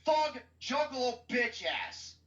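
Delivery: high, emphatic insult; scornful rant. Real, not acted. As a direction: spit the insult out high, word after word. Ranting about hated Joker.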